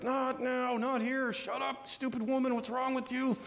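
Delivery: high-pitched, strained mock panic; panicked brush-off. Peter brushing off the servant girl, high mock-panicked protest.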